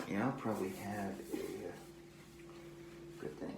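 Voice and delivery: normal talking voice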